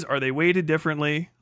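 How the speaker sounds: ordinary delivery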